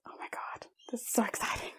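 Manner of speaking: soft, whispered glee; excited whisper. Real, not acted. Planning her project, excited whisper. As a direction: whisper it like a secret thrill.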